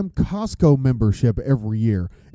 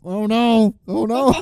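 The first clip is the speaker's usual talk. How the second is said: mock dread; 8 semitones higher, 3 dB louder than usual. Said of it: "that feeling of".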